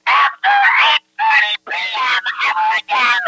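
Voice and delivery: high screamed warning; enraged shriek, forbidding them; furious shouted threat. Real, not acted. Shouted threat during angry call.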